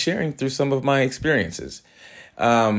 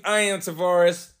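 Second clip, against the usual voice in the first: voice cracking; 9 semitones higher than usual. Real, not acted. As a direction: voice cracks on a high note. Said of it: "my voice just cracked".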